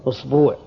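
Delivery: "usbuu'" with a long second vowel; tenth variant. Shown as a demonstration. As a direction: say أسبوع as "usbuu'", u then long uu. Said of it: "اللغة العاشرة". (أسبوع بضم الهمزة ومد الباء بالواو)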